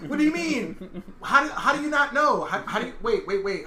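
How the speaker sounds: high, loud, stammering incredulity; mock disbelief. Acted disbelief at Pat.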